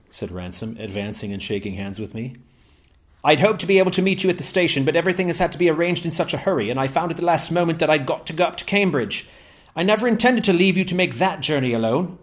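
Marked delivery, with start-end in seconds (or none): 3.2-12.2 higher-pitched, eager English gentleman; Ransom's voice. Acted. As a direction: pitch Ransom up, glad and keen.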